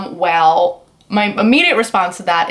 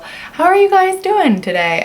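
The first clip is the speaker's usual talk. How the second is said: infomercial host; 10 semitones higher, slower than usual. Pretend. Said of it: "Sound like an infomercial".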